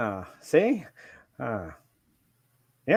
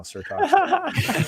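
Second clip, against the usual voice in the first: laughing; 4 dB quieter, much slower than usual. Real, not acted. Laughing teasing friend.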